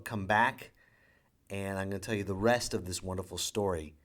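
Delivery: ordinary talk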